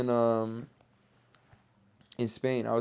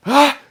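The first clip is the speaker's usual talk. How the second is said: a high, loud mock scream of fear before the jump; a short high yell, half playful; retold scream; 13 semitones higher, 13 dB louder than usual. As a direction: yelp as if standing at the cliff edge. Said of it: "I was like".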